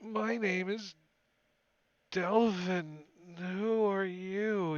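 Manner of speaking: a dazed captive squinting at the light; weak prisoner. Acted. Prisoner Delvin introduces himself.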